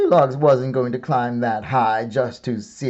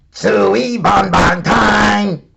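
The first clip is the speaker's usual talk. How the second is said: louder, gleeful monster yell; dinosaurs yelling; 9 semitones higher, 8 dB louder than usual. A narrator's reading. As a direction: holler it like a hungry pack. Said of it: "a trio of dinosaurs yelling".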